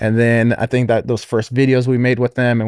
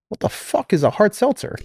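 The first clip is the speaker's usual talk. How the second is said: retold disbelief, pitched much higher; imitating a confused buddy, higher voice; mock baffled friend; 10 semitones higher, 3 dB quieter than usual. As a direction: play the friend's baffled question, up high. Friend retold asking on phone.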